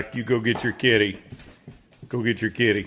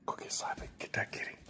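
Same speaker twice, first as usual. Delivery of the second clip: whisper; 19 dB quieter, faster than usual. Real, not acted. Egging on dog at cat.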